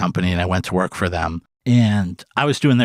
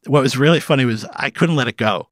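ordinary talk

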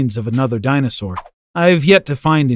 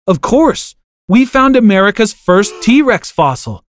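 higher and louder, bursting with excitement; excited; 6 semitones higher, 3 dB louder, slower than usual. Acted. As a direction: blurt it out thrilled. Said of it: "I replied in excitement".

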